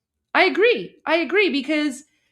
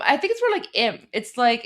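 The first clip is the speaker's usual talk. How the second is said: ordinary talk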